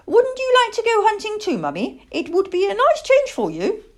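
put-on high, loud child-owl voice; eager young owl wheedling; coaxing owlet. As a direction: coax mummy in a piping little voice. Plop coaxes his mother to hunt.

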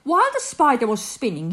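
ordinary delivery

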